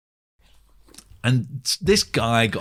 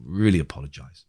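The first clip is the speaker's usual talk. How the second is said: apologetic; 8 semitones lower than usual. Retold or imitated. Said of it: "emailed Howard and said".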